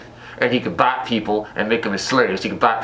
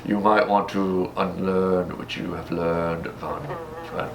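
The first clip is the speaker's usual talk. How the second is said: Dracula; 4 semitones lower, 3 dB quieter than usual. Retold or imitated. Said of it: "Then he goes".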